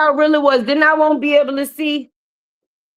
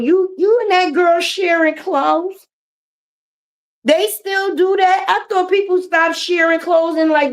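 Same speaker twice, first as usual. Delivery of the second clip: incredulous; 5 semitones higher than usual. Real, not acted. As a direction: stretch out the disbelief. Incredulous slow delivery.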